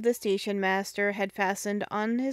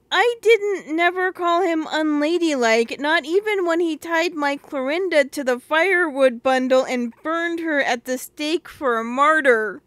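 tearful protest; 5 semitones higher, 5 dB louder than usual. Acted. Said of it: "sobbed Phyllis".

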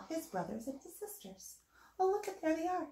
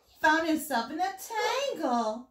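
plain reading voice

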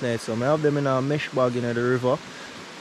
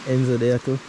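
normal talking voice

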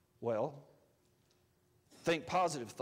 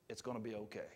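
soft, hushed library murmur; timid library hush; 8 semitones lower, 11 dB quieter, faster than usual. Shown as a demonstration. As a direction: say it meekly under your breath. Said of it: "not like you're at the library".

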